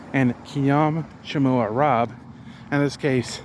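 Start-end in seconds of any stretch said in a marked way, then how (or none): 0.4-2.1 very slow, deliberate "Kiyam Chamua Rob"; slow careful Khmer. Shown as a demonstration. Learner giving his own name in Khmer, very slowly. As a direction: lay out each Khmer word slowly for the viewer. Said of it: "I'm using it as my".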